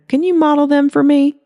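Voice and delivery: high, playful ask; flirty request; coy Holly. Acted.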